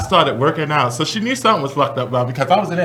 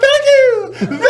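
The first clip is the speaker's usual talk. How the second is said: diva thanking the crowd. Retold or imitated. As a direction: gush like a diva on stage. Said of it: "I had the big hair".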